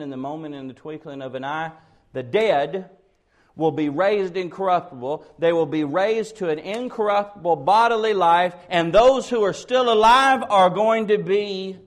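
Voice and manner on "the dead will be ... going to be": loud proclamation, rising in pitch and force; preacher swelling to full volume; building preaching. Acted. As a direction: build steadily louder toward a cliffhanger.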